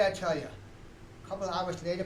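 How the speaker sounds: normal talking voice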